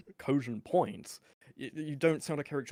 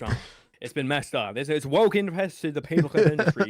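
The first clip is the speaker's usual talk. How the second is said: loud ranting put-on voice; Eric July impression; 7 dB louder than usual. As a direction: rant like a pundit, pushing hard. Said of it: "I just switched accents into".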